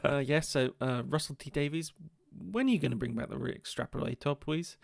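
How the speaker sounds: soft, hesitant asking; meek polite request. Acted. Fan asking the writer for a favour, meek and soft. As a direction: ask quietly and politely after the outburst. Calm polite redo of shouted demand.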